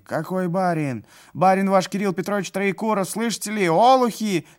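high, loud bellowing official; furious. A narrator's reading. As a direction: roar at the crowd. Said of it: "грозный исправник".

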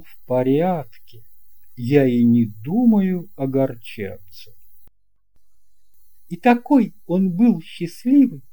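plain reading voice

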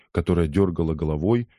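plain reading voice

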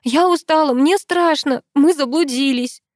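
scared, exhausted complaint; tearful wail. A narrator's reading. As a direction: whine it as if close to crying. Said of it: "Снова заплакала я".

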